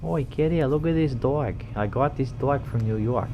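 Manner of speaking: high, loud, hammed-up New York accent; a badly faked New York street voice; mock New York accent. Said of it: "I try and mimic all of these accents".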